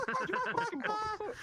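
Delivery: high, slow, squeezed out through laughter; laughing. Real, not acted. Telling friends the mugging story, high slow laughing. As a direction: crack up as you say it.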